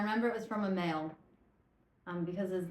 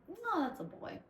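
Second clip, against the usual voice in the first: much higher, playful insistence; silly insistence; 11 semitones higher than usual. Retold or imitated. Tipsy host insisting every animal is a boy, squeaky high. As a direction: squeak it out like a certain little claim. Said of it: "I'm like".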